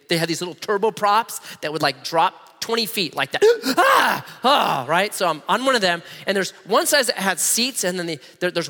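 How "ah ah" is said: mock gasp of fright. Mimicking the turboprop dropping, sharp scared gasp. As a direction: yelp like the plane just dropped.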